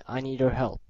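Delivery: usual speaking voice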